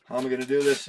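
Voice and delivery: usual speaking voice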